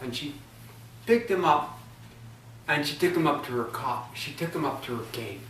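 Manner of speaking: ordinary delivery, nothing marked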